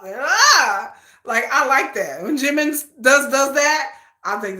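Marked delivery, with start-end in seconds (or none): 0.0-0.9 "Yeah" swung up high and loud; rising Busan intonation. Retold or imitated. Demonstrating how Busan intonation goes up, high loud swoop on "Yeah". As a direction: mimic Busan talk with an exaggerated upward swoop on "Yeah". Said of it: "like, it goes up".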